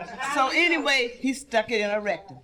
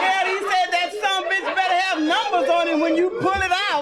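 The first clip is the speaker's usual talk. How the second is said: loud, higher angry yell; angry husband; 8 semitones higher, 3 dB louder than usual. Acted.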